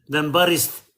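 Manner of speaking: ordinary delivery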